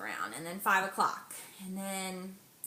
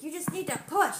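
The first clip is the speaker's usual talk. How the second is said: urging command; 8 semitones higher, 6 dB louder than usual. Retold or imitated. Midwife urging during pushing.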